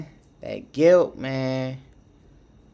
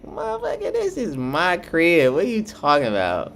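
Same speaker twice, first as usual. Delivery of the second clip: high-pitched imitated protest; mock indignation; 6 semitones higher, 3 dB louder, faster than usual. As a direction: act out an indignant homeowner, starting very high. Voices woman defending her house.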